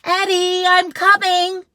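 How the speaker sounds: high, loud mom calling. Acted. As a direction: call across the house. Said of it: "called Mom".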